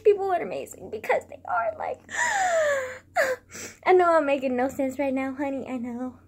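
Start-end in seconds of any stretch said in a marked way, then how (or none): 2.0-3.8 sobbing gasp. Real, not acted. Overcome mid-sentence.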